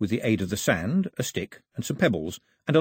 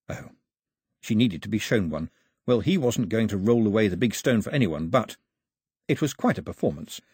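usual speaking voice

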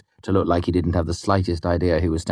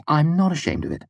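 higher-voiced proud woman; 10 semitones higher than usual. A narrator's reading. Devi owns up to her expulsion, proudly. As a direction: declare it proudly. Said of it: "Devi said proudly".

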